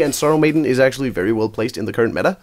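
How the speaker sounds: ordinary talk, nothing marked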